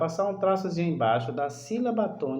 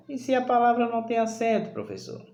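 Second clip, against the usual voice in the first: quick, higher-pitched pupil's question, dropping on the last word; student's question; 7 semitones higher, faster than usual. Acted. Teacher voicing a pupil who asks about unaccented words, quick questioning. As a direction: ask it as a pupil interrupting the teacher.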